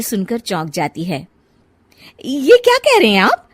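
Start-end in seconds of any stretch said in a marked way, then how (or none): 2.2-3.5 loud, high shocked protest; shocked disbelief at him. Acted. Wife shocked at husband.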